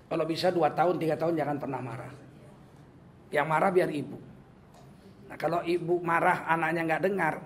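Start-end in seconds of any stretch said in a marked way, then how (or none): none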